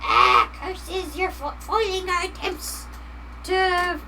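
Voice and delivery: high, grumbling cartoon villain; gremlin voice. Gremlins complaining to the streamer, high villain grumble. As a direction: curse like a thwarted little gremlin. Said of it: "they're just like".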